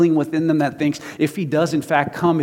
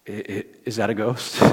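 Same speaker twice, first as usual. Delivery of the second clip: hushed, lower, hesitant stammer; mock fearful stammer; 5 semitones lower, 5 dB quieter than usual. Preacher imagining himself seeing Jesus, playfully scared stammer. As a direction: act timidly unsure, softly.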